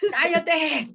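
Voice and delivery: ¡Cállate! snapped in a high, desperate shout. Shown as a demonstration. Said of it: "Así con desesper". (¡Cállate! gritado con desesperación)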